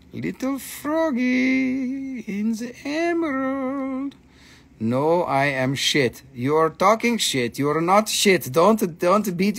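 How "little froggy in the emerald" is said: higher, slow cooing. Pretend. Seller cooing at the carved frog, higher slow coo. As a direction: coo at the little froggy. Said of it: "cooing at frog carving".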